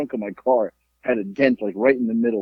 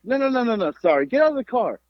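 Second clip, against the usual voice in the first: high, insistent retold objection; mock protest; 9 semitones higher than usual. Driver ordering old motorist out of the car, high mock protest. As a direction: refuse the apology in a raised pitch.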